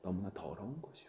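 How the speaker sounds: hushed whisper. Acted. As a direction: drop to a whisper for the confession. Confession of inner filth. (속삭이듯 고백)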